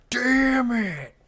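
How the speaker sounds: high, drawn-out mock yell of frustration; high-pitched, hammed-up cry; mock gamer outburst. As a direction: imitate a friend raging at a game. Imitating friend's in-game outburst.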